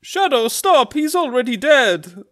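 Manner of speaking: high, loud horrified plea; Mario. Acted. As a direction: beg a friend to stop, urgently. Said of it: "then Mario goes".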